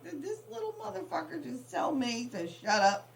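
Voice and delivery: high, outraged mock question; indignant mom. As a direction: huff it out, scandalized. Retold outrage at son.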